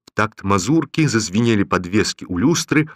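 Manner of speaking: ordinary delivery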